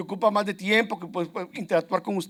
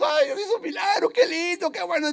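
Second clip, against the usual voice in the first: gushing delight; 7 semitones higher than usual. Pretend. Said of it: "ay, lloramos".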